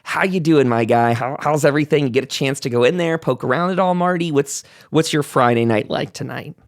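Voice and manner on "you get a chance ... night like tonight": ordinary talk, nothing marked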